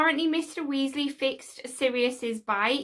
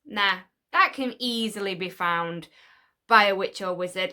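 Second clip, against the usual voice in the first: ordinary talk, nothing marked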